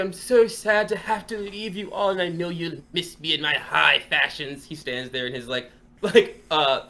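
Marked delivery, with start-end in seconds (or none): 0.0-4.7 high, loud, showy noble voice; audacious troll speech. Acted. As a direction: preen like a vain lord addressing his fans. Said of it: "audacious, like, troll speech".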